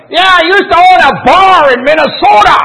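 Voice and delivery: hard-of-hearing loudness. Retold or imitated. Old Joe talking to Tabitha during the sermon, mock shouting. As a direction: blurt it out like a deaf old man thinking he whispers. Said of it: "you might hear something like".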